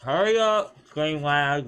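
high, loud burst of impatience; irritated yell. Real, not acted. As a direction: yell it at the lagging game. Irritated at lag during game.

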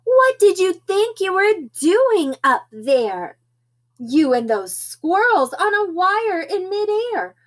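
high-pitched storybook telling-off; hammed-up worried mother's lecture; scolding mother. Mother scolds her kitten son.

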